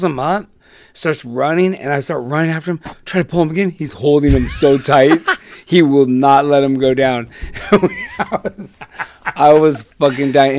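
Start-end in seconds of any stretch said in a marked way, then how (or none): none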